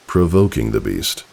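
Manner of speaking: plain reading voice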